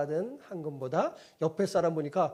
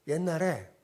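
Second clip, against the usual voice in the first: usual speaking voice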